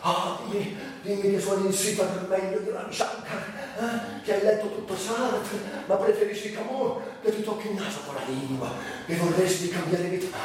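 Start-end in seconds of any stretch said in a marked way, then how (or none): none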